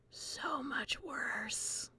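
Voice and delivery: slow ominous whisper; spooky whisper. Pretend. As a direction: breathe it like a ghost story. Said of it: "oh, you think this is bad?".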